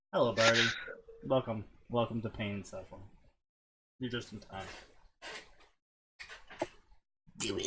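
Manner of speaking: low, hushed villain impression; Emperor Palpatine. Villain welcomes Birdie to pain and suffering, hushed and sinister. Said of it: "read this like Emperor Palpatine".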